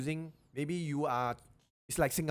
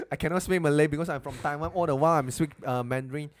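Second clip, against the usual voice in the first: louder, quicker put-on excuse; mock excuse; 3 semitones higher, 7 dB louder, faster than usual. Returnee from Taiwan excusing himself to a Malay speaker, mock excuse. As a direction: play the returnee pleading ignorance, louder.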